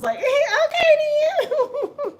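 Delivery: high squealing laugh through the words; real laughter, high. Real, not acted. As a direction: laugh out the reply. Laughing while retelling the flirt.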